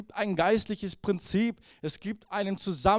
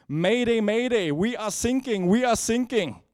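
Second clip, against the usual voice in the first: loud, higher panicked English distress call; hammed-up sailor shouting for help; mock mayday call; 4 semitones higher, 5 dB louder than usual. Sinking English sailor radios the coast guard, mock panicked shout. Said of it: "ein englisches Boot ... setzt den Funkspruch ab".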